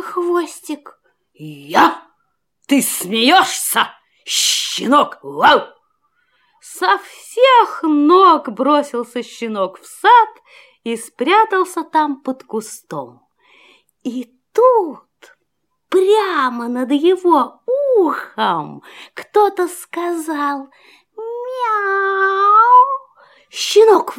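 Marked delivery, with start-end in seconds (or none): 1.3-5.6 big dog voice. A narrator's reading. 21.2-22.9 kitten meow. A narrator's reading. Kitten meows.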